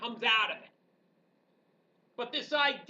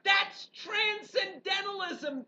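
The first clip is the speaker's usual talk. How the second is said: higher, louder, slow declaration; teacher triumphantly announcing the point; excited exclamation; 6 semitones higher, 3 dB louder than usual. Real, not acted. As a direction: land the big reveal. Triumphant punchline to class.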